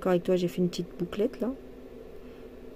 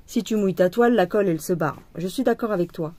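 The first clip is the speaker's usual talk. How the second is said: ordinary delivery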